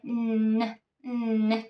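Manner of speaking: "んーね" with the tongue pressed to the ridge; tongue-tip n sound. Shown as a demonstration. Teacher shows on the linking into the. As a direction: hum "んーね" tongue on the upper gums, sliding into the next vowel. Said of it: "onのNの音が上顎にペタッと".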